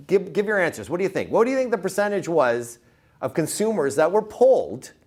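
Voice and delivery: ordinary talk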